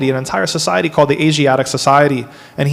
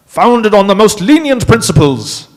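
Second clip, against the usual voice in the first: put-on British accent, higher and louder; 6 semitones higher, 4 dB louder than usual. Lecturer redoing the quote for the class in British accent. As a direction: read the quote again as a posh Brit. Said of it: "can I do my British accent here".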